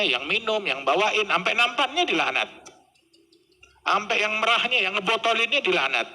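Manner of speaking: high, emphatic sermon condemning sin; fiery preaching. Acted. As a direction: preach it hard from the pulpit. Preaching at full force. (khotbah berapi-api)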